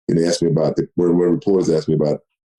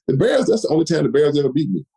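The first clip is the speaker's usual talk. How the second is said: normal talking voice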